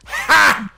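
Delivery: sharp, high, mocking bark of a laugh; one loud derisive ha, high in the voice; scoffing laugh. Real, not acted.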